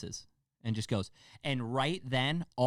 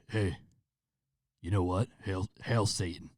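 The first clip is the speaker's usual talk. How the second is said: low, halting villain attempt; clumsy attempt at a scary killer; bad scary voice; 3 semitones lower than usual. Shown as a demonstration. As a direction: try a menacing voice, badly faked. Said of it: "that was a bad voice".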